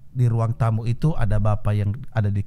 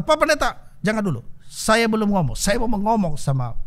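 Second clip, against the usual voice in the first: son interrupting; 8 semitones higher than usual. Retold or imitated. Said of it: "Anak laki-laki berkata".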